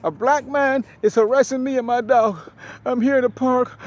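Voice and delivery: high, loud mocking imitation of a panicked caller; mock frantic woman. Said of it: "She says".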